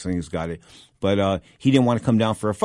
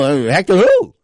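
puzzled; 8 semitones higher, 9 dB louder than usual. Retold or imitated. Confused at the news.

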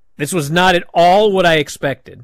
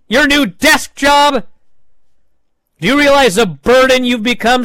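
retold angry yell; 9 semitones higher, 5 dB louder than usual. Woods keeps accusing Big E.

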